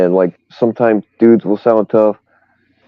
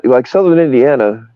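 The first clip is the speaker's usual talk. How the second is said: ordinary talk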